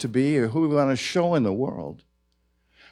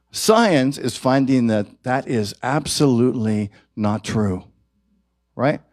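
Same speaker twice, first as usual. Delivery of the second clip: normal talking voice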